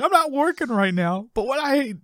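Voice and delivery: high-pitched sitcom protest; imitating an indignant braggart; acted boast. Acting George's boastful line.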